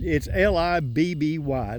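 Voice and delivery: slowly spells out L I B B Y, letter by letter; spelling out a name. Shown as a demonstration. Gardener spells his wife's name for viewers, slow and letter by letter. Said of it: "just in case you can't understand".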